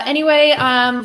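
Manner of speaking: usual speaking voice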